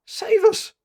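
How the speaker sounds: high, loud astonished Scottish cry; awe-stricken cry. Acted. Scottish tailor stunned by the cheque, crying out in awe. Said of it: "cried the awe-stricken Scot".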